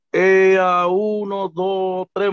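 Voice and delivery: drawn-out, very slow counting of blocks. Retold or imitated. The stranger tells the lost newcomer the way, counting slowly. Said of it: "me lo contó así mismo".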